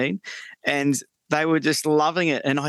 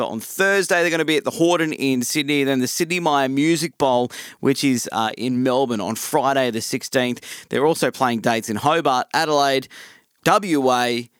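ordinary talk, nothing marked

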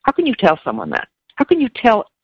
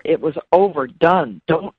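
ordinary delivery

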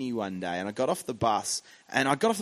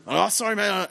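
grunted sorry; 7 semitones higher than usual. Retold or imitated. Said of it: "he sort of go, grunt".

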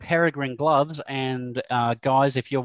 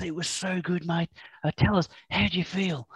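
hushed Kiwi interviewer voice, softer; soft Kiwi accent; 4 semitones higher, 5 dB quieter than usual. Retold or imitated. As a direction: quietly imitate a Kiwi reporter. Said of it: "He does, doesn't he?".

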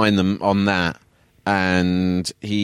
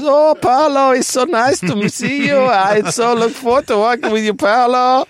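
much higher and louder, scared fawning voice; scared Nigel; 6 dB louder than usual. Retold or imitated. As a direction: squeal nervous welcome like a frightened man. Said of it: "And he goes".

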